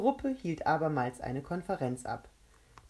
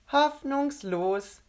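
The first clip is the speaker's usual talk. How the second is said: high, loud, drawn-out verdict; smiling dismissal; 10 semitones higher, 7 dB louder, much slower than usual. A narrator's reading. The mouth judges Rebecca's attempt, smiling and drawn-out. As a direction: stretch the word out gleefully through a smile. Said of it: "sagte der Mund lächelnd".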